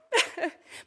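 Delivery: a short high laugh breaking through tears; emotional laugh. Real, not acted. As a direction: let a teary little laugh escape. Emotional nominee thanking her family.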